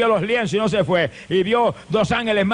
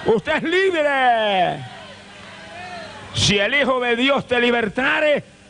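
preaching higher and slower to a climax; rising sermon; 4 semitones higher than usual. Acted. Preacher tells sinners they are free, building fervour.

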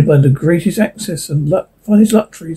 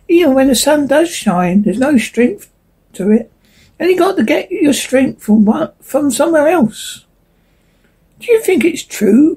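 a touch higher, wistful small leaf; lightly voiced leaf; 8 semitones higher than usual. A narrator's reading. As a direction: speak as a fragile autumn leaf.

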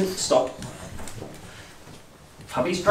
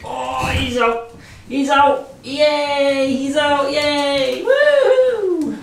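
much higher, loud cheering; 12 semitones higher, 6 dB louder, much slower than usual. Real, not acted. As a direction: celebrate like a cheerleader. Cheering dog leaving bath.

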